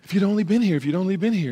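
mildly dramatized frantic outcry. Retold or imitated. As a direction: imitate a distraught, reproachful plea. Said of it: "And she's frantic".

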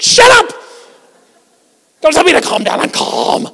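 high, explosive acted shout; worked-up angry outburst. Worked-up person told to calm down, furious high shouting. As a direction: blow up at the person calming you. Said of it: "when you're really worked up".

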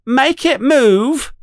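very high, slow demanding yell; a spoiled kid yelling; yelling boy. A narrator's reading. Dudley yelling at his father by the glass. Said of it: "yells to his father".

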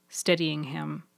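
ordinary delivery, nothing marked

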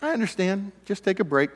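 soft, patient voice of God; a calm, hushed divine voice; quiet voice of God. Retold or imitated. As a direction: let God speak gently and quietly. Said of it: "God says".

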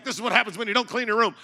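fast, loud mock lecture to the kids; hammed-up fatherly lecture; mock scolding. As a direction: scold them like a dad making a point. Dad lecturing scared kids.

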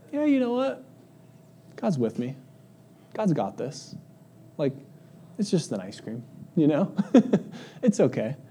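gentle reassurance. Retold or imitated. Calm adult telling themself it's only ice cream, gentle. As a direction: settle yourself down kindly. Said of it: "and we go".

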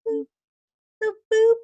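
high, bouncy boop boop boop; playful boop sounds. Retold or imitated. Describing a thought landing, playful high boops. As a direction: pop each boop out like a drop landing.

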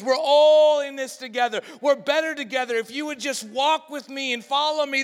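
loud, high, building preacher's call; rally the crowd to unity; rousing preaching. Acted. Preaching at full force.

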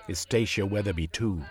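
ordinary delivery, nothing marked

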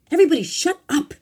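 very loud, high command; fed-up shout. A narrator's reading. Girlfriend in front seat shouting back at bickering friends. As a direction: yell to silence the whole group. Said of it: "Marianne shouted back at them".

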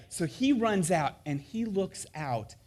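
ordinary delivery

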